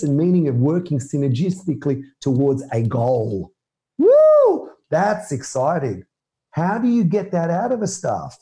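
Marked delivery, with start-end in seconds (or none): 4.0-4.7 high, loud "woo"; excited whoop. Shown as a demonstration. Speaker cheering working towards a goal, excited whoop.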